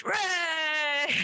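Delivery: loud high cheer; whole bar cheering hooray. Retold or imitated.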